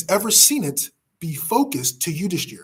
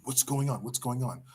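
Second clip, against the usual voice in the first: anxious hushed question; 4 semitones lower, 8 dB quieter than usual. Acted. Blind king asks his assistant.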